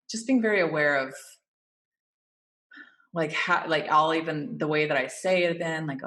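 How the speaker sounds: ordinary delivery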